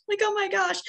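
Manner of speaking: high, teary laugh-cry on oh my gosh. Retold or imitated. [name] retelling opening the gift, teary laugh-cry. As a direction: let the voice crack into tears. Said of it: "And I'm crying".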